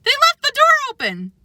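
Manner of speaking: high, excited call; high excited urgency; urgent cry. A narrator's reading. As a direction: shout the escape news. Animals spotting escape route.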